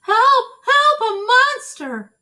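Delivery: high, loud screams for help; panicked shout. Acted. Baby screaming for help at the monster. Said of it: "shouted Baby".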